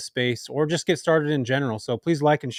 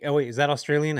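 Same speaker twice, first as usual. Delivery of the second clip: ordinary delivery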